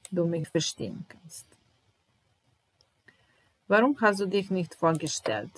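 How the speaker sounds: usual speaking voice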